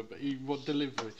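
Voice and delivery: ordinary talk, nothing marked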